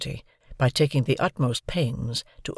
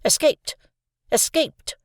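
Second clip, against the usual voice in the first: snappish sister; 13 semitones higher, slower than usual. A narrator's reading. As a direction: snap it out at the boy impatiently. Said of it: "said snappishly".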